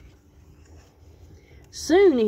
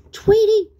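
high, astonished shout; loud exclamation; 10 dB louder than usual. A narrator's reading. Sylvester seeing Tweety unmasked, loud astonished cry. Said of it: "exclaimed Sylvester".

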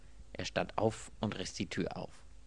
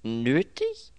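loud, raised, questioning; incredulous echo; 6 semitones higher, 8 dB louder than usual. A narrator's reading. Robbed man questioning his servant's remark, incredulous. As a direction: throw the word back in disbelief.

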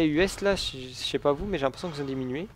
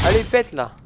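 shouting; 7 semitones higher, 10 dB louder than usual. Real, not acted. Urging during a firefight.

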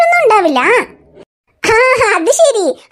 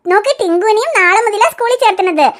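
usual speaking voice

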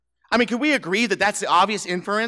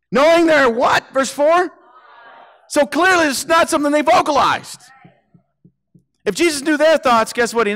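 much higher, louder preacher voice; forceful preaching; 9 semitones higher, 6 dB louder than usual. Acted. Preacher driving the point home to the congregation, loud and high.

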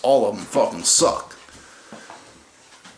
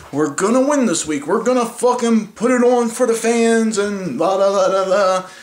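higher, louder mock pep-talk voice, hammed-up; mocking team hype; 7 semitones higher, 4 dB louder than usual. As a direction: parody the team promising a win, pumped and dumb. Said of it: "every week acting like".

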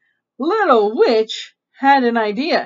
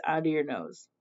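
plain reading voice

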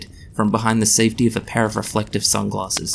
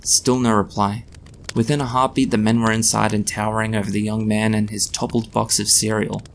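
ordinary delivery

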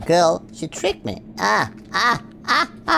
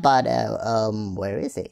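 ordinary talk, nothing marked

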